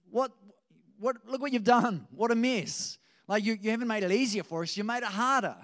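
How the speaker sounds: mock outraged complaining; indignant complaint. Israelites complain to Moses in retold story.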